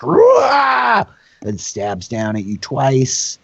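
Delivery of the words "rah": high war cry with effort; battle roar. Acted. Spearman lifts shield to stab, high battle roar. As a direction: roar as you raise the shield and strike. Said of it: "he lifts the shield, like".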